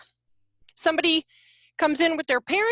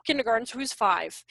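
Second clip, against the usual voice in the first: ordinary talk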